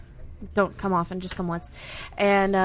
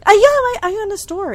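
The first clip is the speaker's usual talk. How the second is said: flustered; 12 semitones higher, 9 dB louder than usual. Real, not acted. Guest flustered, jumps high.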